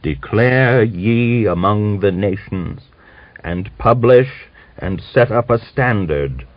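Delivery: slow, every syllable enunciated, solemn; old man reading Jeremiah aloud slowly; slow scripture reading. A narrator's reading. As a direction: intone the prophecy like a sermon. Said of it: "slowly, enunciating each syllable".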